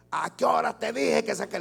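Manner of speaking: padre sin autoridad gritándole al hijo; grito de padre. Acted. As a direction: grita la orden, fuerte y agudo. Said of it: "Le estoy gritando". (regaño a gritos, voz alta)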